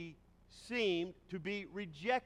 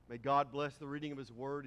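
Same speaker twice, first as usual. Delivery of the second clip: ordinary talk, nothing marked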